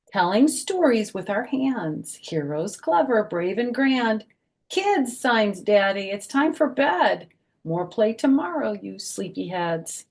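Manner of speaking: bouncy rhyming picture-book voice; reading to children. Acted. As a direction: read the rhyme aloud to a group of little kids. Reads rhyming picture book aloud.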